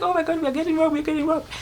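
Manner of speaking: put-on reliving a child's terror; panicked. Child in the car realising they are being robbed, panicked. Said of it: "So I was like".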